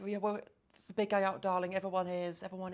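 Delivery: put-on a touch of British nurse accent, fading at the end. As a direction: play the British nurse, drawing out darling in a light British accent. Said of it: "British, loved it".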